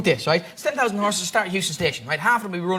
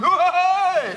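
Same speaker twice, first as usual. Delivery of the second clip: taunting zebra; 10 semitones higher, 4 dB louder than usual. Acted. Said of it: "David Attenborough and you see a lion chasing a zebra and he's going".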